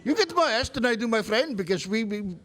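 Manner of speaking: Indian accent. Shown as a demonstration. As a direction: try an Indian accent, faltering out. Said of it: "do a better accent".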